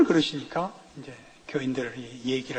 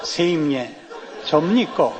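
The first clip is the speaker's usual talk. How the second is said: Gyeongsang dialect; 4 semitones lower, slower than usual. Retold or imitated. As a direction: repeat the punchline in a mock Gyeongsang accent. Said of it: "가롯 유다가 그렇게 부르더래요".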